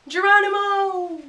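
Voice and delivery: high, loud, drawn-out whoop; a duck's gleeful cry as he jumps; excited yell. A narrator's reading. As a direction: leap and shout it with joy. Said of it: "Duck is really excited now".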